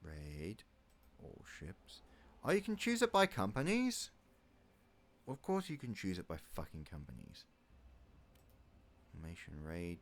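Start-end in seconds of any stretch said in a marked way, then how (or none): 2.4-4.1 higher, louder, rising on "by"; genuinely surprised discovery. Real, not acted. Discovering a game option. 5.3-7.4 dry, softer, falling, stress on "course"; mock obviousness, deadpan; sarcastic. Real, not acted. Answering his own question sarcastically.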